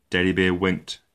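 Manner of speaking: ordinary delivery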